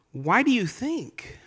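high, slow gentle asking; a light touch of Jesus gently probing; voicing Jesus's question. Jesus's question, acted quote.

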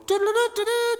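high squeaky sing-song taunt; mocking taunt. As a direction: tease like a playground bully.

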